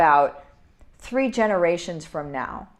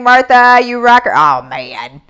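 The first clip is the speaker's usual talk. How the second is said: ordinary talk, nothing marked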